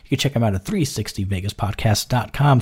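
ordinary delivery

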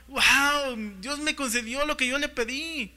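high, delighted "wow"; acted wide-smiled wonder; beaming amazement. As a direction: play Solomon thrilled, grinning ear to ear. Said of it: "la sonrisita colgate".

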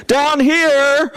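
high call from down below; calling from a grave. Acted. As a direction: play the trapped jogger calling up. Voice from inside the grave.

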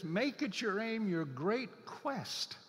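"great quest" drawn out very slowly; slow, savoring. Shown as a demonstration. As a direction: linger on "your great quest" as something precious. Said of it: "something about that word quest struck me".

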